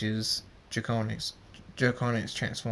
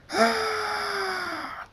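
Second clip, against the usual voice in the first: long, very high airy cry; high scream. Acted. Zapper as the clouds start to swirl, screaming. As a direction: let out a drawn-out high cry.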